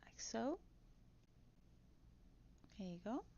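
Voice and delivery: ordinary talk, nothing marked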